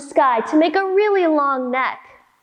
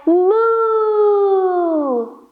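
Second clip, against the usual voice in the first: drawn-out mooing cow; 4 semitones higher than usual. Shown as a demonstration. Yoga teacher shows the kids a cow moo, drawn out.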